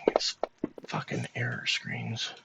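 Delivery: muttered under breath; whispered curse. Real, not acted. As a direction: whisper the swear in annoyance. GM curses at software under his breath.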